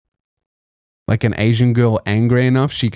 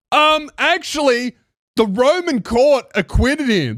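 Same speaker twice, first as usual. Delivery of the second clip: high, slow nerdy "um, actually" voice; mock pedant; 10 semitones higher than usual. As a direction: play the smug know-it-all correcting someone. Mocking a pedantic commenter.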